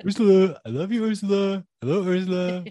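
high, sweet lover's coo; boyfriend voice. Retold or imitated. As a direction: coo it to your girlfriend. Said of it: "Don't use that boyfriend voice".

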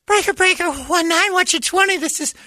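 put-on very high kid voice on the CB radio; little kid calling out over a walkie talkie.

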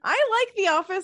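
high, loud defensive protest. Real, not acted. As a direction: protest a little too loudly.

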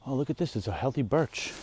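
ordinary talk

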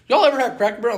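high, loud eager call-out; excited retold question. Camper asks the kids about a restaurant, high loud eager question. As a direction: ask the whole group, fired up.

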